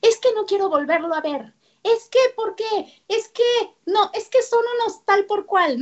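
high, exasperated mock rant; complaining parent. Parent ranting about the teacher, high exasperated mock voice. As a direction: whine it like a fed-up parent. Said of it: "y los papás que se".

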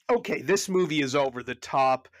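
ordinary delivery

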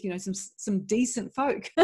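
usual speaking voice

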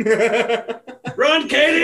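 high, loud laughing yell; laughing mock horror scream. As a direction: shriek it like a horror movie. Mock scream at imagined victim.

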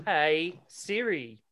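slow, drawn-out Aussie "Hey Siri"; Aussie accent. Pretend. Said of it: "your Aussie accent's bullshit".

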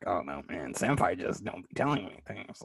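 low, soft Beavis impression. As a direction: do a hushed Beavis whine. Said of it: "make him sound like fucking Beavis".

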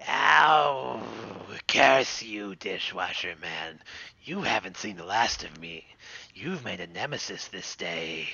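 low, soft defeated villain; sputtering foiled villain. Acted. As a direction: vow revenge as you slink away.

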